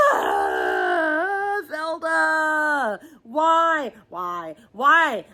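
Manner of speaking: high, crying shout at the author; anguished cry. Real, not acted. Reader crying out to the author over the ending, anguished. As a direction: wail the name in despair.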